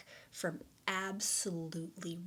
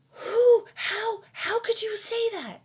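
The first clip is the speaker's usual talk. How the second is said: much higher and louder, frantic self-scolding; frantic inner critic; 13 semitones higher, 12 dB louder, slower than usual. Retold or imitated. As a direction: shriek at yourself like a panicking inner critic. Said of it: "I would be like".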